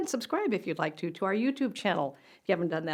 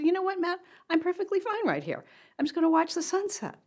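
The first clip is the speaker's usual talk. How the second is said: much higher, sing-song insistence; stubborn reply; 11 semitones higher than usual. Retold or imitated. Mom telling her son she's fine, high breezy insistence. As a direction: breezily brush him off. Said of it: "I said".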